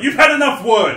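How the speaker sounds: high loud You've had enough; mock dismissal. Telling a customer to stop, loud mock scold. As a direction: shoo them off loudly. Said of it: "you're just like".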